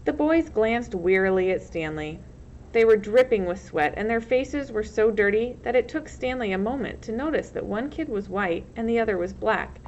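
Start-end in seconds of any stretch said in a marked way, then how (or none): none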